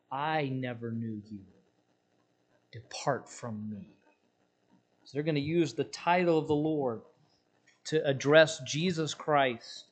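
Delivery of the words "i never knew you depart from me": low, soft, slow verdict; solemn hush. Acted. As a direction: pronounce it quietly and final. Jesus pronouncing final judgment.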